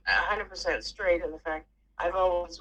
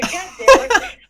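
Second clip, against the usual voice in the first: loud, much higher laughing shout; laughing yell; 16 dB louder, slower than usual. Retold or imitated. Cursing her late mom out loud to the air, laughing yell. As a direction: yell it at the sky, half laughing. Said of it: "I said it to the air".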